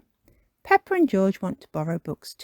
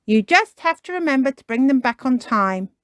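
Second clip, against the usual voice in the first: high, loud reminder; Miss Rabbit; 7 semitones higher, 6 dB louder than usual. Acted. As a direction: remind firmly in a lifted librarian voice. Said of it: "says Miss Rabbit".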